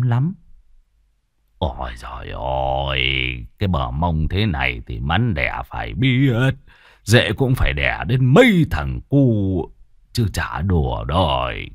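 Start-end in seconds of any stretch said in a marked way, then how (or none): 1.6-11.7 lecherous musing. Acted. Lustful inner musing.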